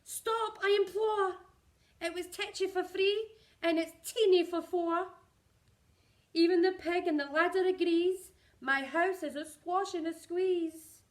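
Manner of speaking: put-on high, loud, desperate old woman's plea; crying out, imploring. A narrator's reading. Old lady crying out as the pig chases the hen, high and loud. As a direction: beg them all to stop. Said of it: "The little old lady cried".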